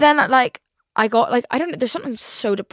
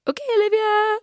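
much higher, sing-song mom voice; high mock mom; 13 semitones higher than usual. Mom giving in to her daughter, high mock voice. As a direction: play mom sighing back in a high voice. Mom's reply.

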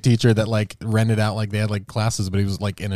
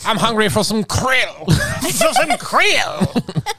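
British whale voice; 9 semitones higher, much slower than usual. Pretend. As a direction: shout it like a posh whale. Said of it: "So they're British".

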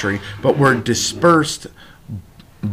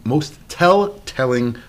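slowly re-saying telltale-ing, word by word; slow careful retry; slower than usual. Real, not acted. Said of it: "I can't even freaking speak now".

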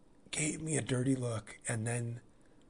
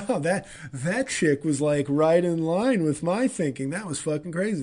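laughing it off; 5 semitones higher, 7 dB louder than usual. Retold or imitated. Laughing off the encounter.

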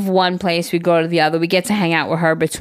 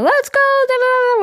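much higher sing-song cheering chant; mock cheer. Mom imitating a parent cheering on their kid, high sing-song.

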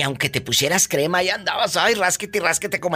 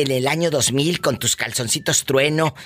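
ordinary delivery

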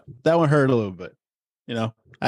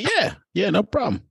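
higher, breezy overconfident reply; cocky dad; 10 semitones higher than usual. Retold or imitated. Dad answering his daughter, cocky. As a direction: brush it off like it's nothing. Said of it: "I said".

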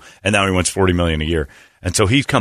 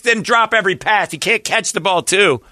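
mock screaming wife; 12 semitones higher than usual. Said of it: "she screamed into that camera".

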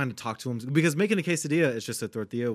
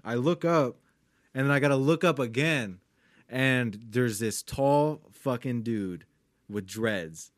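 ordinary delivery

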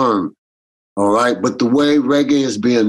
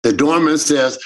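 ordinary talk, nothing marked

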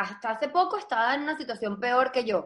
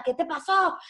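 worried mom; 5 semitones higher than usual. Retold or imitated. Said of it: "Mi mamá me atendió angustiada".

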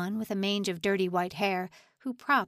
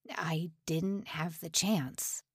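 fearful whisper; 2 semitones lower, 4 dB quieter, slower than usual. A narrator's reading. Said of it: "something of a tremor now".

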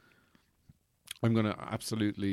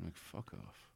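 muttered under breath; 4 semitones lower, 19 dB quieter, slower than usual. Retold or imitated. Muttered retort to mum.

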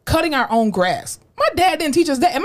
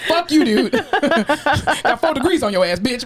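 mocking professor; 5 semitones higher, slower than usual.

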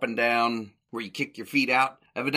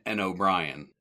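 ordinary delivery

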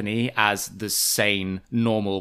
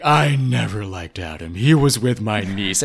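put-on slow, lightly slurred drunk blurt; drunken blurting; 3 semitones lower, 3 dB louder, slower than usual. Luann drunk at the table, a mild slow slurred blurt. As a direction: blurt it like a tipsy aunt. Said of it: "drunkenly blurting out".

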